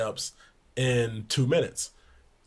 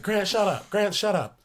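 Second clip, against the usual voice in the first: high mock snap at the player; imitating coach snapping; 8 semitones higher than usual. Coach Barnes telling Grant to shut up, high mock snap. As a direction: shut him up, coach-style, pitched up. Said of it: "And he's like".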